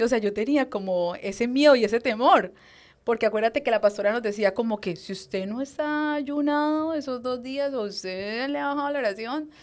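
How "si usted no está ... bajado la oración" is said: imitated pastor's warning. Pastor warning her group about skipping fasts, imitated admonishing. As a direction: stretch it out high, like a warning recited.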